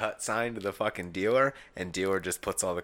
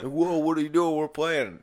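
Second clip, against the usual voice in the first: higher, louder indignant whoa; mock surprise; 9 semitones higher, 8 dB louder than usual. Drunk gambler to security throwing him out, indignant surprise. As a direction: act shocked at being thrown out.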